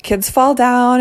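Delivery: soothing nurse. Retold or imitated. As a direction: play the calm nurse calming a frantic mom.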